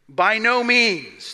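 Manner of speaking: each word of "By no means" spaced out and weighted; a measured, emphatic "By no means"; slow emphatic denial. Acted. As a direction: deliver it slowly, like a firm verdict. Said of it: "This is very, very emphatic".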